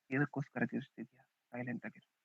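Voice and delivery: very soft, acted order to hush; hushed command. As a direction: drop right down to silence the listener. Voices God ordering silence. (ತುಂಬಾ ಮೆಲುದನಿಯಲ್ಲಿ ಸುಮ್ಮನಿರಿಸುವ ಆಜ್ಞೆ)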